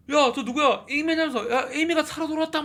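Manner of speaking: high-pitched excited crowd voice; amazed onlooker. Acted. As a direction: call out the astonishing news, pitched right up. Crowd amazed at wife's sudden return. (깜짝 놀라 높은 목소리로 외치는 군중 흉내)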